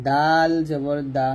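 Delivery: দাল stretched long from the upper teeth roots; letter articulation demo. Shown as a demonstration. Teacher showing students tongue placement, দাল slow and dental. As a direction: hold দাল slowly, then a short দা. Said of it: "যেমন এটা হবে".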